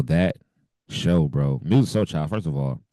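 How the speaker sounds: ordinary delivery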